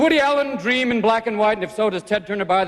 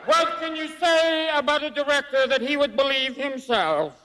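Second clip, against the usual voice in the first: George Jessel; 4 semitones higher than usual. Acted. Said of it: "I will now channel the spirit of George Jessel".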